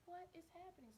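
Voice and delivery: very high, hushed disbelief; stunned, voice climbing high; baffled. Retold or imitated. Learning another staffer quit, high and baffled. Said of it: "I'm like".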